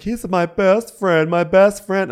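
high, loud, drawn-out imitation; hammed-up best-friend lament; mock distress. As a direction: stretch it high and melodramatic. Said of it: "funny that she's like".

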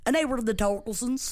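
ordinary talk, nothing marked

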